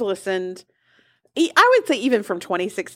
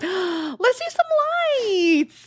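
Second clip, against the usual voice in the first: excited; 11 semitones higher, much slower than usual. Acted. Gasp then excited reply.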